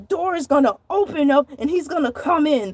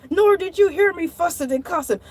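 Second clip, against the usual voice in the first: normal talking voice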